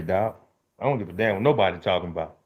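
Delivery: usual speaking voice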